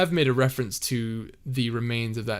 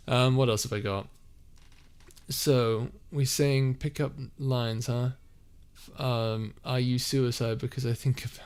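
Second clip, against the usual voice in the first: ordinary talk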